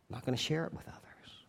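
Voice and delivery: soft unvoiced whisper; whispered aside. Pastor whispering the point to the congregation. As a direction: hush it like a confession.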